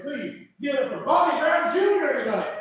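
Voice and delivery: host hyping the next comic onstage; host hype intro. Real, not acted. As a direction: bring the comic up with a big hype.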